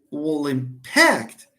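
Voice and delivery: slow, "impact" stressed on PACT; slow verb stress. Shown as a demonstration. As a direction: slowly land on -PACT. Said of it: "it's an impact".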